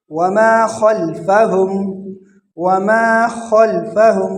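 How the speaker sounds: slow خلفہم with a full, throaty kh; heavy kha. Shown as a demonstration. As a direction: recite وما long, thicken the خ of خلفہم. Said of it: "خا کو موٹا کر کے پڑھیں گے". (خا موٹی کر کے تلاوت)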